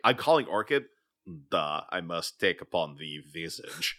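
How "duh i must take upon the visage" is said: put-on low, ominous Belarusian accent; solemn, lower-pitched mock Belarusian accent; thick Belarusian accent. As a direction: switch into a heavy Belarusian accent. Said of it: "the accent just gets thicker".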